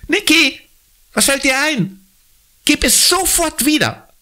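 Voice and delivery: put-on high, indignant girl's voice; scolding the dog. Girl scolds dog Niki for stealing stick, indignant. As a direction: tell the runaway dog off sharply.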